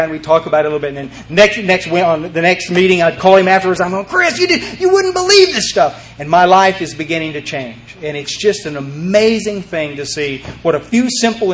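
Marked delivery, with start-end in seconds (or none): 4.1-6.1 much higher, breathless with news; excited. Retold or imitated. Excited newcomer phoning his sponsor after the meeting. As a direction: burst out with the discovery.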